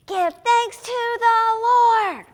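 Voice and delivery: high, slow, full-out shout; rooftop shout. Shown as a demonstration. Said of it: "scream it from the rooftops".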